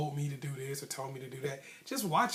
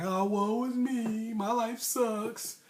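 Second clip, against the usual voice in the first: higher, slow, whiny mock lament; mock self-pity; 6 semitones higher, much slower than usual. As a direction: drag it out like a sulking loser feeling sorry for himself. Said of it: "it's like".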